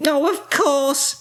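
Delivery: high, slow character voice; sudden realisation. A narrator's reading. Winnie works out what rabbits eat, high dawning realisation. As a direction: say it high and stretched as the idea lands.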